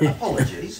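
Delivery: high, loud hammed-up apology. Real, not acted. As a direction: overdo the apology. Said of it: "you say you need sorry".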